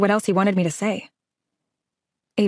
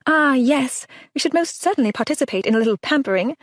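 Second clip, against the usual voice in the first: hammed-up posh British accent, higher; mock-British affectation, playful; ridiculous British voice; 7 semitones higher than usual. A narrator's reading. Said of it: "jabbering in her ridiculous British voice".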